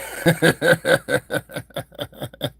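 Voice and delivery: high rapid cackling laugh; real laugh. Real, not acted. Streamer cracking up over a nickname, laughing. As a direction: crack up at your own joke. Cracks up at his own joke.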